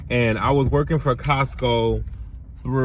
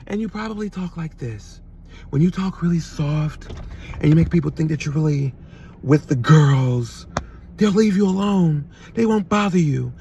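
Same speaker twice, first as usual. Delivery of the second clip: higher and much softer, mincing the words; soft, high mock voice; 2 semitones higher than usual. Shown as a demonstration. Mocking men who talk soft to be left alone, high soft voice. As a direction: put on a soft, high voice to seem harmless. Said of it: "I don't fucking talk like that".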